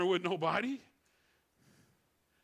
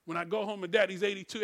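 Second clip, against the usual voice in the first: usual speaking voice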